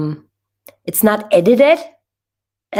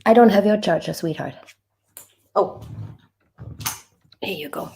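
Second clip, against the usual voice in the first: turning away from phone; faster than usual. Real, not acted. Sorry, that was my son.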